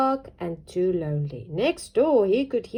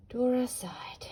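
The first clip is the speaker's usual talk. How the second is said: usual speaking voice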